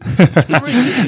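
suddenly high and loud, forced cheer; overdone enthusiasm. Acted. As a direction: ham up the sincerity to prove a point. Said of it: "You didn't seem so genuine on that".

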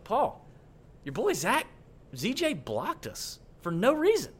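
high-pitched, aggrieved plea to a friend; torn-up complaint. Retold or imitated. Podcaster retelling his complaint to the golfer's caddy, torn up. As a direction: act out a hurt, baffled complaint, pitched well up. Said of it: "I was so torn up about it".

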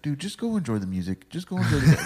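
mock-irritated, building on the repeat; mad brush-off. Tattoo artist shooing off a fan, mock mad. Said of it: "like, all mad at me and shit".

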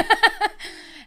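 high loud burst of laughter; cackling laugh. Real, not acted.

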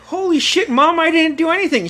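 high, alarmed pleading; reenacted protest of innocence. Son protests innocence to mother.

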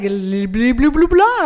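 lightly mocking fake French; mock a smug Frenchman. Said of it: "smuggest non-French asshole that I've ever heard French".

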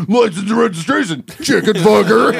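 high mock cop line through laughter; ringtone quote, laughing. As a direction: repeat the cop line while cracking up. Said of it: "what are they hearing".

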